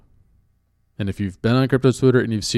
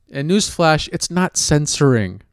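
ordinary talk

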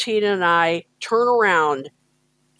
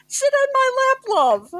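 much higher, badly faked Scouse; a hammed-up Liverpudlian repeat; bad Liverpool accent. Imitating the singer's Liverpool accent, badly. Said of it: "I'm going to do a very bad impersonation".

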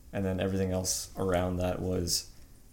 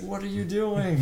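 much higher exasperated squawk; shocked Hayden; 12 semitones higher, 3 dB louder than usual. Retold or imitated. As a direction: squeak it in disbelief. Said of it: "He's like".

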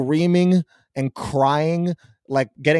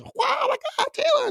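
much higher, shrieking fangirl voice; squealing teen fan; 3 dB quieter than usual. Retold or imitated. Mocking teenage fans at a concert with a much higher squeal. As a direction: squeal it like a starstruck teenage girl. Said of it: "these teenage girls".

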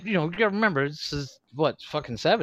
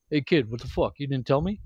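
lightly voiced grumbling movie star; a slightly lower, offhand gripe; Harrison Ford; 4 semitones lower, faster than usual. Leaning forward at the premiere to needle a co-star, light impression. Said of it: "That's, that's so Harrison Ford".

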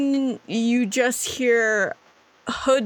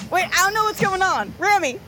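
higher, fast, panicky borrowed voice; a girl flustered in someone else's voice; higher and fast, alarmed in a stolen voice; panicked mimic of Remy; 7 semitones higher, faster than usual. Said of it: "I sound like you".

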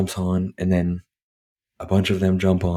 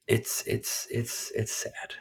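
whispered sadness; 4 semitones higher, 10 dB quieter, slower than usual. Real, not acted. Choked up over sad scene.

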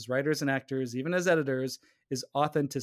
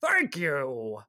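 exaggerated frail old voice; very old man. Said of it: "he sounded even older".

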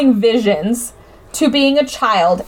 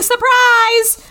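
mock surprise shout; 11 semitones higher, 3 dB louder than usual. Crowd yelling surprise at Nick.

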